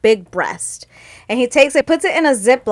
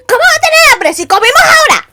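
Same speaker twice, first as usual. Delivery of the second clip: high, loud, fast angry outburst; angry mom; 7 dB louder, faster than usual. Retold or imitated. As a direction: snap back in outrage at the dumb question. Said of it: "pissed 'cause we just ate".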